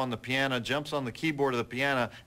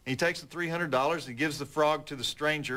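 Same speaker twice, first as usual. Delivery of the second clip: ordinary delivery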